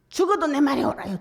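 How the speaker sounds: angry prophet talking back to God; stubborn defiance. Retold or imitated. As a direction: dig in and shout back at God. Stubborn Jonah defies God.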